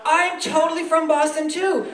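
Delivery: high-pitched, over-eager gush; mock excited gushing. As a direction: imitate a thrilled fellow Bostonian. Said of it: "if they're from Massachusetts, they're like".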